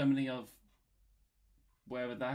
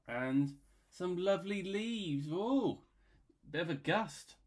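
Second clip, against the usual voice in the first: delighted drawing; 7 semitones higher, 4 dB louder than usual. Acted.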